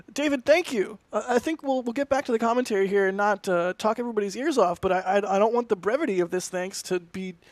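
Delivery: ordinary talk, nothing marked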